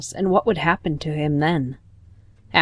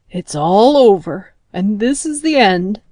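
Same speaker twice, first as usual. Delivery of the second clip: a louder, despairing frog moan; 2 semitones higher, 5 dB louder than usual. A narrator's reading. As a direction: moan it out, sure the end has come. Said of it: "moaned Grandfather Frog".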